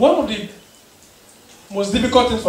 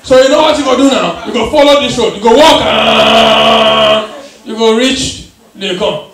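loud, bossy street directions; loud Ghanaian direction giving; 4 dB louder than usual. Retold or imitated. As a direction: bark the route out like a local.

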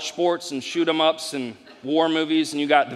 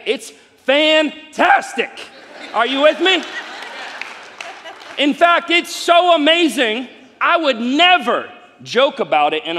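loud, high, fired-up preacher; full-force preaching; 5 semitones higher, 5 dB louder than usual. Acted. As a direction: preach it to the room and win an amen.

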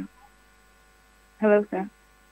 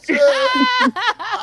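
laughing shriek. Real, not acted. Radio host retelling the prank, shrieking with laughter. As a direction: crack up until it squeaks.

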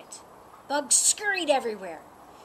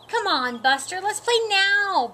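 loud high bark of a plea; 8 semitones higher, 6 dB louder than usual. Acted. Phoebe insisting Buster play now, loud and barking. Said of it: "barked Phoebe".

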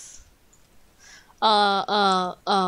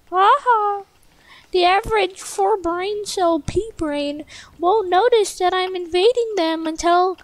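higher, gleeful mock-villain taunt; hammed-up cartoon villain; silly villain voice; 6 semitones higher than usual. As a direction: cackle out threats like a cartoon baddie. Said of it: "I don't know why I'm talking like that".

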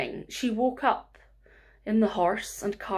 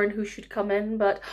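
plain reading voice

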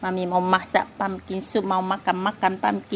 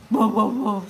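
short woofs like a dog; playful mock woof; imitated barking; 6 dB louder than usual. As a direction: bark like the little dog. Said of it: "suara si".

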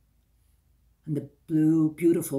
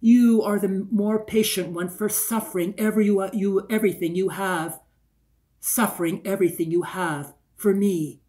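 higher and louder, pouring her heart out; impassioned plea; 3 semitones higher, 7 dB louder than usual. Acted. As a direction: cry it out to the stone, pleading. Rocking her, pleading to stone.

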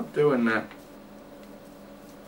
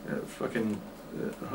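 ordinary delivery

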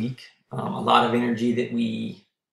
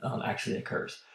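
losing voice; 11 semitones lower than usual. Real, not acted. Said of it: "I'm starting to lose my voice".

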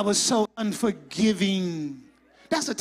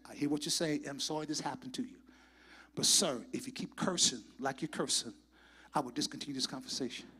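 softer, fast, calm customer-service voice; calm professional; 4 semitones lower, 3 dB quieter than usual. Pretend. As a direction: stay polite and even with the angry caller. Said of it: "I'm gonna say professional a hundred percent".

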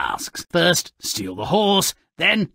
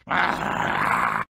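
ordinary delivery

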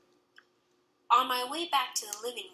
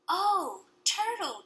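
put-on little girl; 9 semitones higher, 4 dB louder, slower than usual. A narrator's reading. Little girl seeing turtle.

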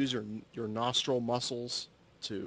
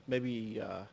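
ordinary delivery

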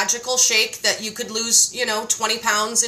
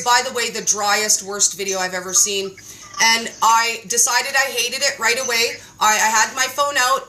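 ordinary talk

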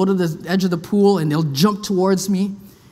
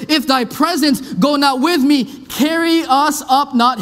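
high, slow, solemn scripture reading; 5 semitones higher, slower than usual. Acted. Preacher reads Moses pleading to the Lord, slow and solemn. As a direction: read the King James words slowly and high.

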